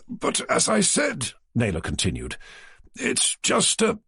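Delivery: a prim, slightly pompous office man; publisher Naylor. A narrator's reading. Naylor's voice, interrupted.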